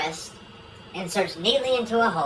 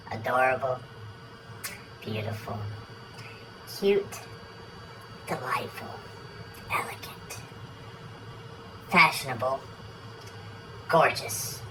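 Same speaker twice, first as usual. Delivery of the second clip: low flattering murmur; 7 semitones lower, 7 dB quieter than usual. Acted. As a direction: drop the voice low and soft, part whispered. Husband flattering his wife.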